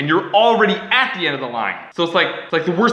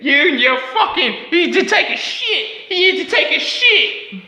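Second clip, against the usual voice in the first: high, slow, mock Australian scolding; imitating Millie's Australian scolding, pitched high; attempted Millie voice; 11 semitones higher, slower than usual. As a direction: mimic a friend's telling-off. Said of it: "I can't really capture Millie".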